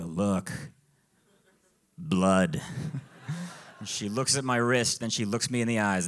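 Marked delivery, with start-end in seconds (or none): 0.0-2.6 very low, mock-solemn voice; low deadpan reveal. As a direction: drop the pitch right down, comically grave. Acted deadpan showing of bleeding wrist.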